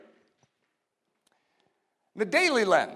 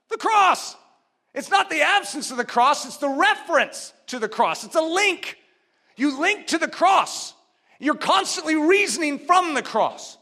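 much higher and louder on The cross; preaching exclamation; 6 semitones higher, 4 dB louder than usual. Acted. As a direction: hammer home the cross. Preaching at full force.

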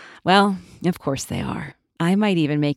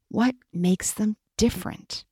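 ordinary delivery, nothing marked

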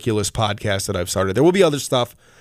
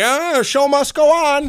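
high, loud, hammed-up proclamation; mock theatrical; 5 dB louder than usual. Partygoers carrying on after a death, mock-theatrical cry. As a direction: play a callous partygoer with mock grandeur.

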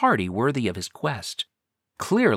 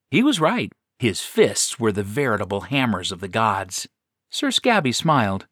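ordinary delivery, nothing marked

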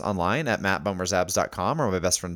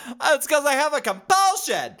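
much higher, hammed-up exasperated wail; high shrieking comic impression; Billy Eichner impression; 3 dB louder than usual. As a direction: squeal it out as a comic celebrity. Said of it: "That was a Billy Eichner impression".

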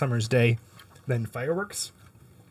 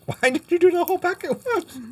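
mocking echo. Mocking echo of friend's question.

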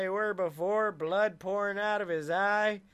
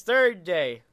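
ordinary delivery

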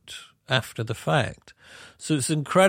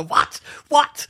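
loud, punchy repeated question; mock disbelief; 6 dB louder than usual. Musician refused another take, loud mock disbelief. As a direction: throw out each word sharply, climbing higher.

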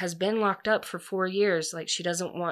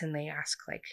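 usual speaking voice

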